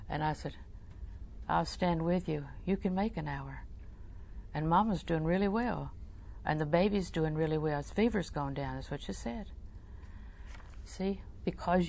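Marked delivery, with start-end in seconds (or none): 1.5-8.8 warm tones. Retold or imitated. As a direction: comfort them quietly, standing by them. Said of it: "your warm tones and voice".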